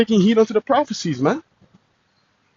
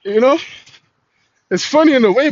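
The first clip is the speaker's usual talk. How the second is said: usual speaking voice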